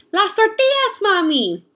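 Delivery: high, bright teasing call; playful child. A narrator's reading. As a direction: tease Mama back, loud and high. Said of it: "Then I say".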